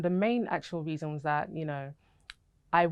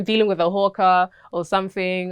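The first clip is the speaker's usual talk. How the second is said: ordinary talk, nothing marked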